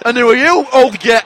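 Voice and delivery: loud cheeky mock heckling; mock heckle. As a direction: jeer at him playfully. Retelling brash banter with host.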